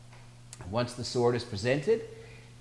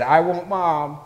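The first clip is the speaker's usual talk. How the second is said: put-on mildly raised, higher little-girl cry; a light mock wail, higher and louder; imitated wail; 6 semitones higher, 5 dB louder, slower than usual. Upset little girl wanting her mother, imitated wail. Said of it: "She started to wail".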